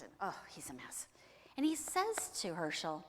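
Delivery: plain reading voice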